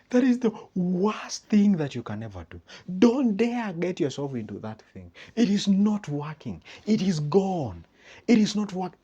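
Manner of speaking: hammed-up friend killing your dream; mock doom warning. Negative friend warns against marriage.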